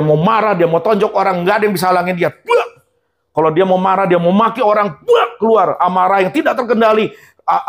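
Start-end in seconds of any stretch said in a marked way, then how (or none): 2.3-2.7 high mock punch noise; a sudden high buak like a blow; punch sound. 5.0-5.4 high mock punch noise; a sudden high buak like a blow; punch sound.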